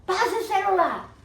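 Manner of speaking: loud, high demanding yell; robber's shout. Acted. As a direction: bark the order like a thief. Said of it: "chega o ladrão".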